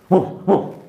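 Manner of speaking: two loud, high barks; fake dog bark. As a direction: imitate a dog to fool someone. Aussie pretending to be a dog.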